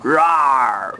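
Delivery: low growling roar; tiger roar. Shown as a demonstration. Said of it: "What does a tiger say?".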